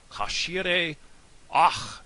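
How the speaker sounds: loud "Caixire ach" with a rasped, throaty "ach"; spitting throat sound. Shown as a demonstration. Teacher drilling the southern toast, "ach" spat loudly from the throat. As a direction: punch out "Caixire ach", ending like a spit. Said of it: "like you're gonna spit".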